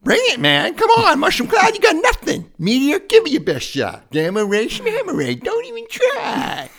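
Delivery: very high, loud slurring taunts; belligerent drunk. Acted. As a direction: yell challenges like a tipsy brawler. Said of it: "A water bear, well, first off".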